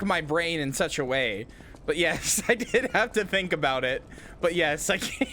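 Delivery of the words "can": high, squeezed out through laughter; laughing. Real, not acted. Streamer cracking up at chat's emote, laughing. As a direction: barely get it out while laughing.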